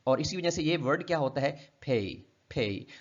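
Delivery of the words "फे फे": फे said slowly with a glide from e to ee; फे drawn out, vowel gliding; gliding diphthong. Shown as a demonstration. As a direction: stretch फे so the vowel slides. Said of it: "इसको glide देकर हम बोलते हैं".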